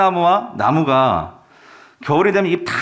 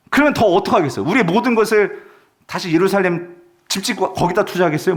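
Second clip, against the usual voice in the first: ordinary delivery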